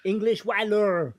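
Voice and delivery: mock French pirate voice, slow; hammed-up French accent. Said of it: "we only hear French accent".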